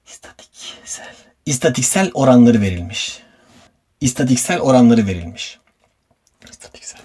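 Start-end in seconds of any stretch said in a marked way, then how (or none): 0.1-1.1 whispering. Real, not acted. Whispers while fumbling the word. 6.5-7.0 whispering. Real, not acted. Whispers while fumbling the word.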